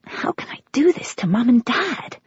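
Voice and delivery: a hushed guilty thought; guilty whisper. A narrator's reading. Daughter fears hurting her adoptive parents, whispering.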